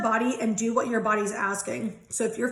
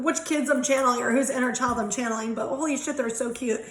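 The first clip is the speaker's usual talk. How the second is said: ordinary talk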